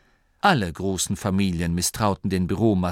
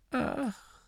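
high, soft scornful "Ach"; mocking; 9 dB quieter than usual. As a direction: sneer quietly. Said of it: "Spott erklang in der Stimme des Abts".

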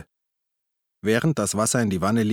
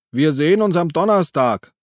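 loud, higher call across the platform; 7 semitones higher, 6 dB louder than usual. A narrator's reading. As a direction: call after them as they leave. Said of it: "Karl rief". (laut hinterhergerufen)

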